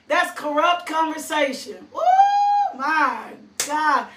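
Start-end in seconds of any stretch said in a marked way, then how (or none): 1.9-2.8 sudden high falsetto ooh; high whoop. Real, not acted. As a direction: let out a joyful high whoop. Preacher reacts to gossip.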